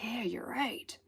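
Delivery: a hushed little yeah, you're right; whispered agreement. Retold or imitated. Whispering to Spirit that it was right. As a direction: whisper it, conceding.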